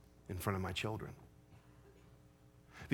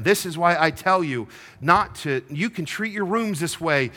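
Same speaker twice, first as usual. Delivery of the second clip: raised, heated scolding of daughters; scolding rant; 7 semitones higher, 6 dB louder than usual. Retold or imitated. Dad scolding daughters over the truck, heated and raised. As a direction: tear into them about the truck. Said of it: "gave them the riot act".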